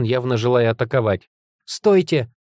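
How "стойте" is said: high, urgent call; crying out. A narrator's reading. Heroine stopping lord's attack, urgent cry. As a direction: call out to halt them. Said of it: "крикнула я".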